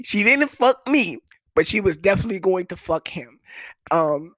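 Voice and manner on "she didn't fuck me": high, loud outraged punchline; indignant burst. Real, not acted. Comic telling the crowd his girl wouldn't sleep with him, indignant. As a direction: spit it out, offended.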